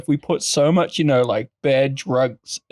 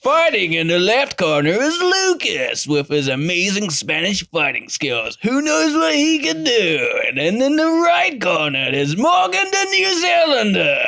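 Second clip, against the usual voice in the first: high, drawn-out fight announcer hype; ring announcer; 8 semitones higher than usual. Pretend. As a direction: stretch the names like introducing fighters. Mock UFC fight announcer introduction.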